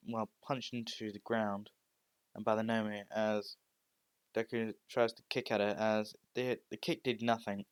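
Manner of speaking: ordinary delivery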